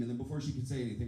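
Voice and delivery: ordinary delivery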